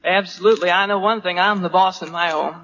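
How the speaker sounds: normal talking voice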